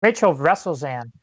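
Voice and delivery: ordinary delivery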